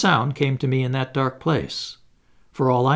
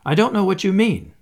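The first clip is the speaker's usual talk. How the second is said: gruff retort, pitched up and louder; rough; 6 semitones higher, 4 dB louder than usual. Acted. Hannay roughly denies his name to the old gentleman, gruff. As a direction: throw it back at him roughly. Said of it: "I said roughly".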